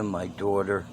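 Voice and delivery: ordinary talk, nothing marked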